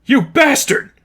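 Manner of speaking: high, loud enraged cry; furious outburst. Acted. Zane enraged at Lipov, loud furious shout. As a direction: spit the insult.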